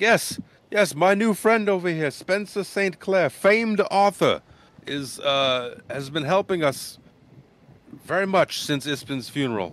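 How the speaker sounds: high, loud mayor urging the crowd; rally the town to flee; the mayor introduces Spencer and orders everyone to the docks; urgent mayor. Acted. Mayor urges evacuation.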